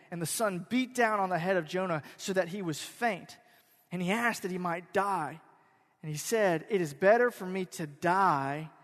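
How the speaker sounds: loud, measured Bible reading from the pulpit; scripture reading. Acted. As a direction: proclaim the verse to the congregation. Pastor reads scripture aloud.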